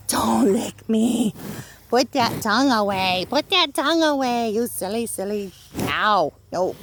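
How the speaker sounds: loud, slow mock scolding; playful scolding. Real, not acted. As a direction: fondly tell off a pet. Scolding the licking cow.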